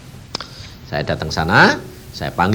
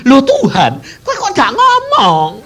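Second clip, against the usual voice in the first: complaining to God; 6 dB louder than usual. Retold or imitated. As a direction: argue with God in disbelief. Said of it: "aku tadi bilang gini". (protes heran kepada Tuhan)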